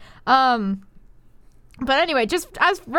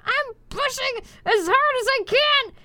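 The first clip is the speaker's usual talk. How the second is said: mock scream; 8 semitones higher, slower than usual. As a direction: yell through the pain of pushing. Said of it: "I screamed".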